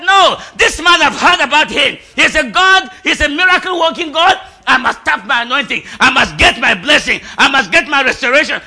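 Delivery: loud, high voiced declaration; woman's cry of faith. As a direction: shout her resolve. Woman with issue of blood quoted.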